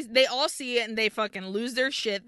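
ordinary talk, nothing marked

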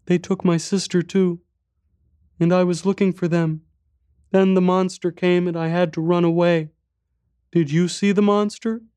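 put-on high, small child voice; little boy. A narrator's reading. Boy tells stranger about lost mother, little boy's voice. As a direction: speak as a young boy, pitched high.

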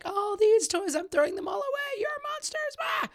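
high-pitched frantic parent meltdown; mock screaming. Mom screaming at her kids over toys, mock screaming. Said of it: "just screaming at my kids".

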